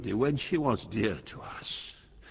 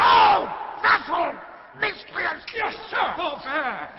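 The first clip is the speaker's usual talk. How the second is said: a king in full fury; shrieking, outraged curse; explosive rage; 7 dB louder, much slower than usual. Acted. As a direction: scream the insults high and loud. Lear exploding at Kent.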